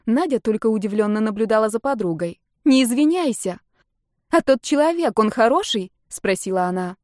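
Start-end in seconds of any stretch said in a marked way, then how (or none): 2.6-3.6 higher, emphatic outburst; emotional exclamation. A narrator's reading. Marta stops her cousin apologising, overcome with emotion. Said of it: "от переизбытка эмоций". 4.3-5.9 higher, keen, pressing question; eager question. A narrator's reading. Marta asks about her cousin's beloved, eagerly.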